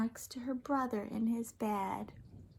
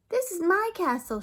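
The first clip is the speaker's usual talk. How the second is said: put-on higher, louder child voice for D W; proud little girl showing off her castle; a child claiming her castle; 9 semitones higher, 8 dB louder than usual. A narrator's reading. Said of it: "she said".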